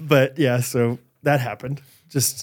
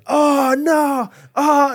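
high, drawn-out cry of mock dismay; hammed-up high-pitched "blinded" wail; playing blind dad; 10 semitones higher, much slower than usual. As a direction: pretend to be blinded, wailing it high. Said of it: "I was so into character".

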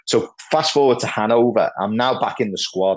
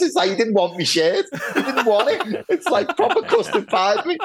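laughing through the words, voice jumping higher; cracking up at his own embarrassment. Real, not acted. Telling the podcast host, laughing at himself.

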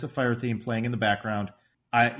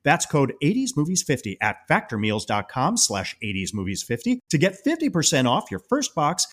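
higher, swooping sponsor-read voice; podcast ad read; 5 semitones higher, 4 dB louder than usual. Acted. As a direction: sell it with punched, bouncy emphasis. Host reads sponsor message.

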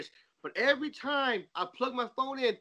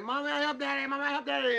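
lightly voiced toddler, proud and a bit higher; child voice; 6 semitones higher than usual. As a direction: play the little boy bragging to mom. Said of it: "run away and be like".

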